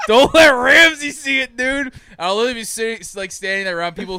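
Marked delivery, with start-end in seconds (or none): 0.1-1.9 loud laughing mock warning; teasing partygoer. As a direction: tease through laughter, slowly. Party guests teasing him.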